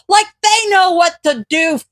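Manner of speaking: furious ranting shout; yelling. Acted. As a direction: yell it out in outrage. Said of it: "Midoriya yells".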